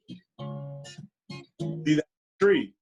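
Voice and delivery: ordinary delivery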